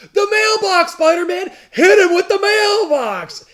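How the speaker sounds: high, loud, slow ringside shout; wrestling crowd yell. Retold or imitated. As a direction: cheer him on like a wrestling fan. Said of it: "then someone says".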